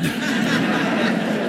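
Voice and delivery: soft breathy chuckle; laugh. Real, not acted. Laughing while retelling prayer.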